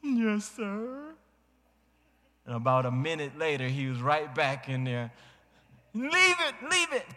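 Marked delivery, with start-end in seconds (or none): 0.0-1.1 put-on high small-boy yes sir; child's reply. Son answers father. 5.9-7.1 put-on very high, squeaky child's demand; imitating child. Boy demands ball again.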